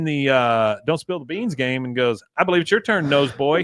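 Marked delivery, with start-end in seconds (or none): 2.4-3.7 mocking Peggy. Retelling Peggy's needling line.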